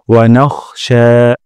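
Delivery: "নাখশা" with a guttural খ, not কাফ, then a long শা; slow, scrape the kh and stretch the end; throaty kh, long sha. Shown as a demonstration. Teacher warning against saying নাক. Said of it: "খ উচ্চারণ করতে হবে".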